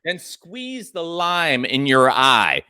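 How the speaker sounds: slow and deliberate, landing the joke; slow punchline. Acted. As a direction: draw out the punchline slowly. Punchline, slowed delivery.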